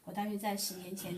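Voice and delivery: normal talking voice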